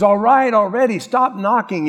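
irritated neighbor yelling through the door; annoyed shout. Retold or imitated. Grumpy neighbor shouting at the knocker, fed up.

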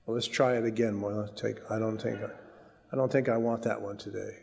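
low, soft reluctant aside, as if to oneself; reluctant murmur. Pretend. Preacher imagining someone drawing an unwelcome promise card, reluctant. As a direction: quietly back away from it, pretending. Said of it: "Can you imagine pulling it out".

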